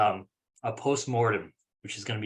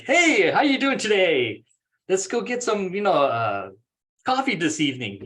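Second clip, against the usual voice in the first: higher and louder, bursting with energy; energetic and upbeat; 5 semitones higher, 5 dB louder than usual. Shown as a demonstration. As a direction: greet them like you're thrilled to see them. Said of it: "get some energy in there".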